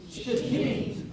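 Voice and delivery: Shittim as shuh-TEEM, for others to repeat; pronunciation demo. Shown as a demonstration. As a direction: model Shittim with the stress on TEEM. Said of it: "How about I hear that from you all?".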